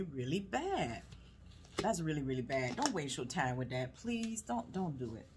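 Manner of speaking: ordinary talk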